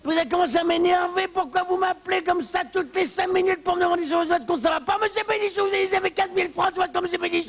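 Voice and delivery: exasperated banker. Acted. Banker snapping at the client who keeps calling back. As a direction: lose your patience with the pestering client. Banker loses patience at caller.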